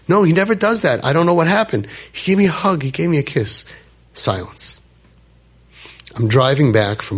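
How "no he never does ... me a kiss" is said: put-on higher, breathless retelling of a boy's awe; overwhelmed excitement. Boy telling the rabbi about his grandfather's hug, excited. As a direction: relive the boy's stunned joy. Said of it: "He's freaking out".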